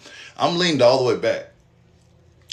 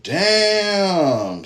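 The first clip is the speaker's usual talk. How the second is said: high, stretched in stunned awe; high drawn-out damn; 10 semitones higher than usual. Retold or imitated. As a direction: let it hang up high. Seeing hand full of blood.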